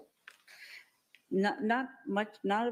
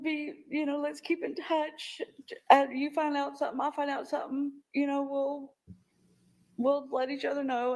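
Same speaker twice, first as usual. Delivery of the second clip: higher voice, still thick with tears; tearful; 8 semitones higher than usual. Real, not acted. Witness retelling her promise to nephew, tearful. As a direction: push on through the crying.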